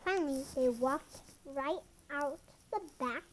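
ordinary delivery, nothing marked

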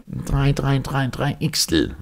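up the scale. Acted. Orderly mocking the professor by climbing the scale. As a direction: run the drei up the musical scale. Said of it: "durch alle Stufen der Tonleiter".

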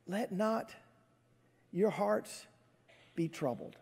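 soft, tender, with pauses between phrases; gentle reassurance. Acted. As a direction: say it softly, without any reproach. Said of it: "but he doesn't. He just says".

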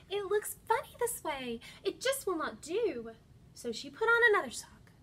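animated storybook voice with dramatic pauses; reading to children. Acted. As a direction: read it like story time, building suspense. Reads picture book to children.

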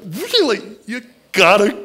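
high, loud disbelieving cry; you can't be serious, up high and loud; incredulous. Acted. Mary announcing, disbelieved.

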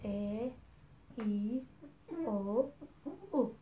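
teacher models each short vowel slowly, one by one; short vowel sounds. Shown as a demonstration. Teacher showing students the short vowels, modelled clearly. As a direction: say A, E, O, U as clean separate short vowels. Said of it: "This is short U or A".

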